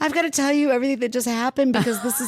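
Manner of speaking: high, rushed, tearful outpouring; tearing up. Retold or imitated. Woman at the bar explaining the coincidence, tearful retelling. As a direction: blurt it out high and fast, close to tears. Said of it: "like, tearing up".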